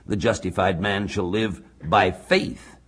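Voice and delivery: "faith" set apart and stressed as the key word. Shown as a demonstration. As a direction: hold back, then lean on "faith" at the end. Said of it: "it's on the faith".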